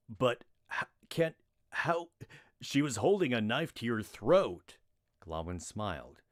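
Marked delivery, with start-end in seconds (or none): 0.1-4.8 sputtering disbelief. Acted. Stunned guard protests.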